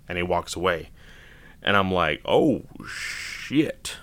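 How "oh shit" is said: drawn-out, breath-heavy dismayed exclamation; mock shock on hearing bad news; stunned reaction. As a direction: let it out slowly, as if stunned. Acts out reaction to grim news.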